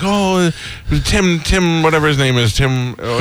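put-on high, gushing teen girl voice; imitating giddy young girls forgetting a name; excited fan girls. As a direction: squeal it like a starstruck young fan.

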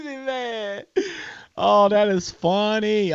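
ordinary talk

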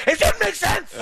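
high-pitched, laughing imitation; crying laughing. Imitating a friend's rant at a referee, helplessly laughing. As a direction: squeal it out through helpless laughter. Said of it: "you could see him shaking".

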